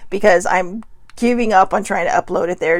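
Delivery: usual speaking voice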